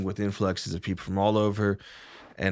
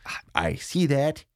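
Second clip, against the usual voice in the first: ordinary talk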